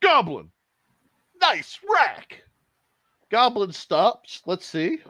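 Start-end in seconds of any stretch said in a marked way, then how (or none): 0.0-2.4 cheeky mock call to a stranger. Pale Rider to the goblin chick, cheeky calling out. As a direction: call it out as a lost hiker would. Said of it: "He goes".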